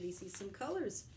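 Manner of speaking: ordinary delivery, nothing marked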